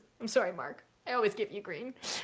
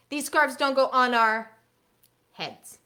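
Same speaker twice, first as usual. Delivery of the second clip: teacher fill-in prompt; 5 semitones higher, 4 dB louder, slower than usual. Shown as a demonstration. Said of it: "they fill in the blank".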